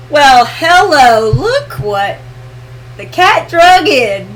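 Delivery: slow, drawn-out teasing welcome; mock-surprised welcome for a late arrival; playful greeting. Real, not acted. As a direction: stretch out the hello, pitch jumping up.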